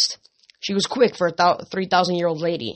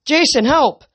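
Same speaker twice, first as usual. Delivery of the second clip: high, loud desperate call; pinned down, calling his friend for help; 8 semitones higher, 7 dB louder than usual. Acted. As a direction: shout across the room for help. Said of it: "Leo called".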